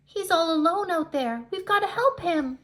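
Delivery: rally the others to act; urgent plea. Acted. Rainbow Fish urges his friends to rescue the striped fish.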